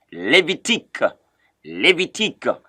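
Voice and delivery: Levitique said "lay-vee-TEEK"; Levitique with a crisp French -teek ending; French pronunciation. Shown as a demonstration. Teacher models it for Anglophones, Levitique as "lay-vee-TEEK". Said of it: "that's how you pronounce it".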